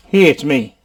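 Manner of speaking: normal talking voice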